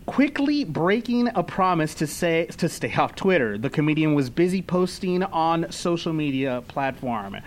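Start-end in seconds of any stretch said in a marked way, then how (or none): none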